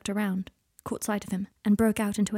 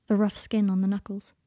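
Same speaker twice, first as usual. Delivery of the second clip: plain reading voice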